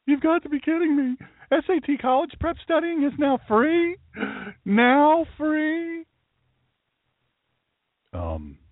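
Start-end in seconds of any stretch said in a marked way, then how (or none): 0.1-6.0 very high-pitched disbelief; mock incredulous. Host scoffing at free SAT prep news, high and incredulous. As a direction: squeak it out high, unable to believe it.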